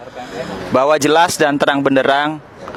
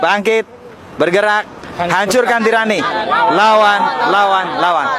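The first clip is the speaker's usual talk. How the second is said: fiery revolutionary speech; 3 semitones higher, slower than usual. Real, not acted. Rally chant closing a speech.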